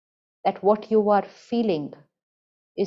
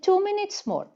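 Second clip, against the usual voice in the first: a little son pleading with his mother for more time; sweet child's plea; 12 semitones higher than usual. Retold or imitated. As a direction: beg sweetly like a small child. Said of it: "He said".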